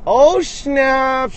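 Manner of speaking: high, loud cry of surprise; sudden exclamation. Real, not acted. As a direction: react to a sudden sighting, stretching it out. Sudden sighting while filming.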